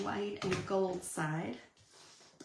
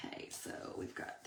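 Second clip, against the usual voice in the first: whispering; 9 dB quieter than usual. Real, not acted. Aside while arranging pieces.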